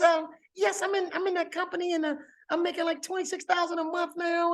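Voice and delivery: high, quick imitation of a friend; Boomy Moore voice. Imitating Boomy telling him her income, high and quick. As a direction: chirp her brag up high and fast. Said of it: "my Boomy Moore voice".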